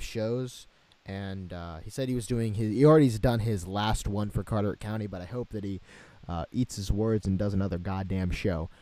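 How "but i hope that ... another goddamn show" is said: ordinary talk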